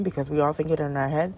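ordinary delivery

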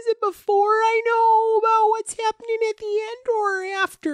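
very high, squealed question; mock agonising. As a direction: squeal it in hammed-up indecision. Agonised quibble over the choice.

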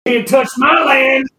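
high, loud, slow yell; cranky villager yelling from the back; shouted protest. Acted. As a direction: bellow it from the back of the room. Old farmer shouts from back.

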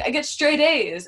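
high, loud, slow and proud; a know-it-all classmate bragging about her grades; smug boast. Retold or imitated. Liberty boasting.

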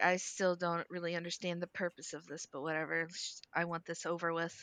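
soft, low, quick grumble; begrudging. Acted. Urza explaining her reluctance to sign, softly begrudging. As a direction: give in quietly, wanting it done. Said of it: "that's why Urza was like".

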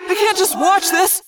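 high, loud anguished yell. A narrator's reading. Tobias deciding to dive in, anguished yell. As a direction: unable to stand by. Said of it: "Tobias yelled".

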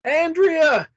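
high, loud call of a name. A narrator's reading. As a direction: call to someone some way off. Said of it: "you call as you get nearer".

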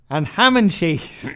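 high, loud, slowly drawn-out list; stubborn barman. Retold or imitated. Barman refusing a plain ham order, stubbornly emphatic. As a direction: finish the list with exaggerated finality.

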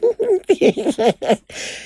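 high, helpless laugh; high burst of laughter. Real, not acted. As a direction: break into a shrill cackle. Laughs at friend's reply.

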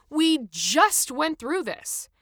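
slow, loud, high exasperation; angry. A narrator's reading. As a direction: drag out the angry protest word by word. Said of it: "Adam said angrily".

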